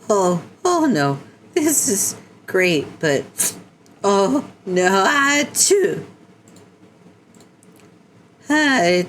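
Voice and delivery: high, cracking panicked voice; squeaky voice breaking in distress. Acted. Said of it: "my voice was cracking".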